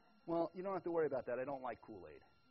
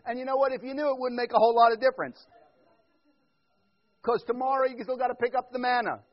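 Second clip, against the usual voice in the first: ordinary talk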